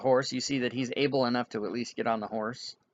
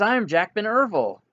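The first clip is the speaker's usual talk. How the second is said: high, swooping declaration of identity; excited realization; 8 semitones higher, 4 dB louder than usual. Acted. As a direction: announce it as a happy revelation. Amnesiac announces his recovered name.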